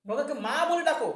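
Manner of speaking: firm command. Acted. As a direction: urge him to call her mother, loud.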